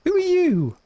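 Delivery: high, loud startled cry; shocked outcry. Real, not acted. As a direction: shout in alarm at the intruder. Catches a thief in the game.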